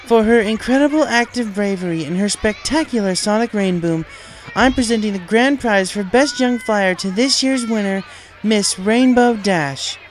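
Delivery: high, loud regal proclamation; royal announcement. A narrator's reading. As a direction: announce to the whole stadium like a princess. Princess announces winner to stadium.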